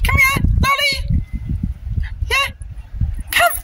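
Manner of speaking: very high-pitched recall call; calling a dog. Real, not acted. Calling her dog over.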